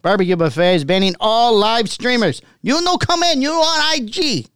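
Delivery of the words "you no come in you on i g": put-on high owner voice, Chinese accent. Owner quote, much higher.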